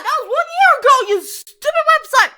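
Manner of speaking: high, laughing shout of indignation; loud outburst. Real, not acted. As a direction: yell it at the website, way up high. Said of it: "Sorry, I started so loud".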